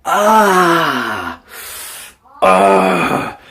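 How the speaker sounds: hammed-up cartoon cries of knee pain; Peter Griffin knee groan. Brother on the ground clutching his knee, hammed-up pained cries. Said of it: "like Peter Griffin holding his knee".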